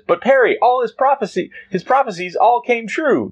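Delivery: put-on very high eager kid voice; high Jimmy voice. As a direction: pitch way up, naive and eager. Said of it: "Jimmy says".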